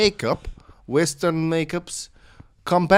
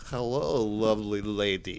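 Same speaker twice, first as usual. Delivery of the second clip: lower, softer, oily greeting; sleazy flirt; 7 semitones lower, 9 dB quieter than usual. Acted. Russian thug flirting with the lady, low and soft. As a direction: lay on the charm.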